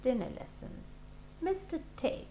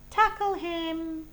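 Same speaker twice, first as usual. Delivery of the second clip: loud, high, slow yell; shouted command; 9 semitones higher, 8 dB louder, slower than usual. Acted. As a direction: bellow it across the field. Games group shouting.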